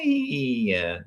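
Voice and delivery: ordinary delivery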